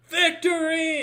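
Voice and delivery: high, drawn-out victory yell; triumphant cry. Acted. Warrior holding up the orc's head, triumphant high cry. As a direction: cry it out to the whole army. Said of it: "and goes".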